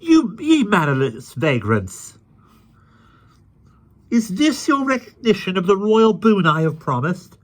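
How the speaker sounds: high, loud royal indignation; sharp rebuke. A narrator's reading. Stung king rebukes the mocking vagrants, high and sharp. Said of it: "He said sharply".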